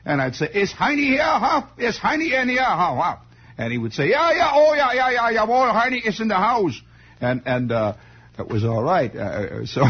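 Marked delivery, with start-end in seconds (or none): 0.5-3.2 high-pitched, exaggerated mock German accent; terrible German accent. Kid making Mister Falpo understand, exaggerated German accent. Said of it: "unless you talked in a terrible accent". 4.1-6.8 high, effusive Bavarian German impression; German accent. Mister Falpo finally understanding the kid, high German accent. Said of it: "rotten Bavarian German accent".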